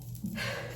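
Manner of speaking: soft acted sigh of a drained parent; long-suffering sigh; weary sigh. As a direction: let out a quiet, fed-up breath. Acting out a mom in off mood.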